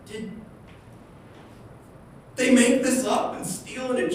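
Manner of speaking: high, stunned disbelief; shocked gasp. Retold or imitated. People discovering the papyrus, shocked and high. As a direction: gasp it out like a scandal just broke. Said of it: "And it's like".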